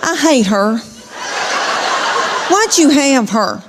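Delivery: mock sibling outburst; complaining daughter. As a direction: imitate an indignant preteen griping at mom. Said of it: "she says something like this".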